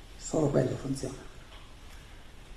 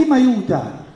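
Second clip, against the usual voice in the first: calling out; 7 semitones higher, 4 dB louder than usual. Real, not acted. Calling for help from audience.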